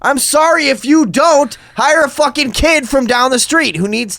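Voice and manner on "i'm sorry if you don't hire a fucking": very high, loud ranting; shrieking at the store clerk in a rage; retold screaming. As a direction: scream it like a drunk meltdown. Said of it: "I'm like".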